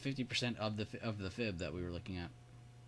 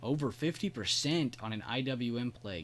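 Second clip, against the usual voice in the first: ordinary talk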